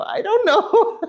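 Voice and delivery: cracking up as you answer; laughing. Real, not acted. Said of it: "I go".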